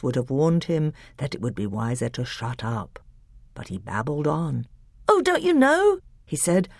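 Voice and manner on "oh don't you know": put-on higher, surprised boyish voice; a child amazed; eager little boy. A narrator's reading. Caspian answers his uncle, surprised and boyish.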